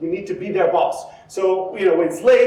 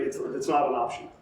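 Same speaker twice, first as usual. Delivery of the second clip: low, soft, immovable refusal; quiet authority; 7 semitones lower, 9 dB quieter than usual. Acted. Doctor answering reluctant patient, quietly authoritative. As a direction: shut it down calmly and low. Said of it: "has that level of authority".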